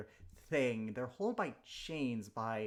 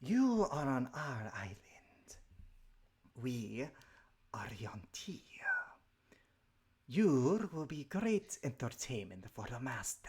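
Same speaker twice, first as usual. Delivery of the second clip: hissing, breathy serpent whisper; menacing snake creature voice; 2 semitones lower, slower than usual. Acted. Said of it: "I have to speak with the snake voice".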